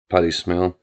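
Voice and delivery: ordinary delivery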